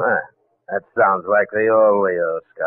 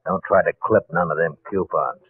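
ordinary delivery

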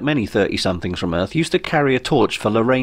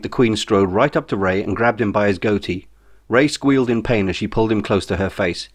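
ordinary delivery, nothing marked

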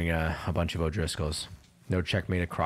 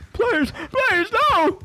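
very high, loud falsetto pleading; mock panicked begging; 9 dB louder, slower than usual. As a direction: play a hammed-up victim screaming for mercy. Acts out victim begging in game.

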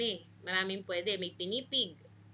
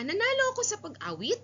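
Nanalo ako sa pag-awit? much higher and louder, rising at the end; rising question tune; 12 semitones higher, 6 dB louder than usual. Shown as a demonstration. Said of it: "Yung una".

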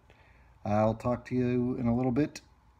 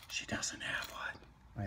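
hushed whisper so nobody hears; 12 dB quieter, slower than usual. Real, not acted. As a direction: whisper it like a secret. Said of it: "I have to whisper".

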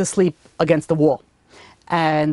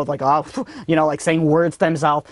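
usual speaking voice

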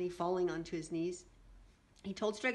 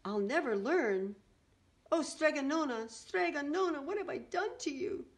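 howling lament; 7 semitones higher, 3 dB louder than usual. A narrator's reading. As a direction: wail in remorse, rising higher. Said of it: "howled Big Anthony".

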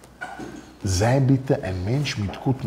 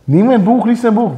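father's loud nagging; 9 semitones higher, 8 dB louder than usual. Retold or imitated.

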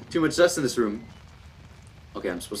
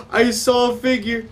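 gasp, then high alarmed cry; real scared, raised pitch; frightened; 8 semitones higher than usual. Real, not acted. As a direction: blurt out the sighting in fright. Glimpses figure in dark.

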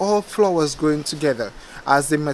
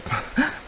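a quick, high little laugh at herself; short laugh; 11 semitones higher, 4 dB quieter than usual. Real, not acted. Gardener laughs after fumbling the word, amused giggle. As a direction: giggle off the mistake. Laughs at her own slip.

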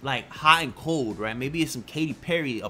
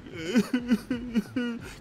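high-pitched mock sobbing; imitated crying; 10 semitones higher than usual. Man retelling how coughing blood made him cry, mock sobbing. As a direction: act out tearful whimpers in short bursts. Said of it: "I was in tears. I was like".

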